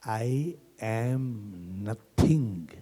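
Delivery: soft, with pauses between "I", "am" and "nothing"; hushed, drawn-out contemplation; slow, deliberate. Real, not acted. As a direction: let each word sink in, very slowly. Same statement repeated slowly, softly.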